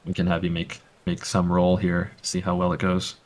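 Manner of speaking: ordinary talk, nothing marked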